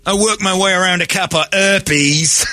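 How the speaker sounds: mock Cockney bragging. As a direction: put on a high Cockney accent. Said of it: "she's Cockney".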